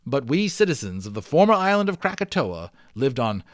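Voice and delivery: lively read-aloud storyteller; story narration. Acted. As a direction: tell the adventure with growing drama. Reads the adventure story aloud.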